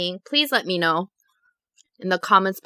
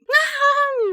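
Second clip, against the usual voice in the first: mocking parental cackle. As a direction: laugh her story off like a parent. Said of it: "They start laughing".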